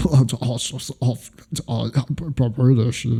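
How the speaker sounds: a friend parroting his mate's put-on British voice; mock British accent. Mocking a friend's semi-British accent. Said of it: "the little nuance that you put in your voice".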